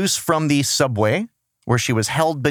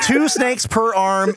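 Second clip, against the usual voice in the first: laughing; 8 semitones higher, slower than usual. Real, not acted. Laughing at snake arms.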